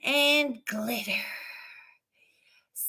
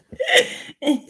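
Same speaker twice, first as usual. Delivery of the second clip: high burst of laughter; real laugh; 6 semitones higher, 3 dB louder than usual. Real, not acted. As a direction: crack up at your own joke. Laughs at own scenario.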